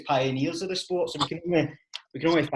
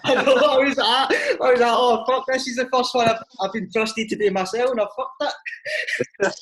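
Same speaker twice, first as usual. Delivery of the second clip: laughing; 8 semitones higher, 6 dB louder than usual. Real, not acted. Laughing while recounting panic.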